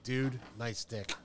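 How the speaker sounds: a hint of a deadpan compliment before the grapple. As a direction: lay it down slowly with a straight face. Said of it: "you gotta have the right inflection".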